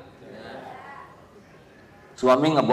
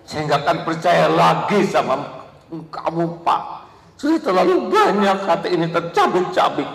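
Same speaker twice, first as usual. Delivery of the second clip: higher, mildly hammed-up wounded wife; mock hurt wife; 6 semitones higher, 6 dB louder than usual. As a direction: act the heartbroken wife with a wink. Imitating the wife again.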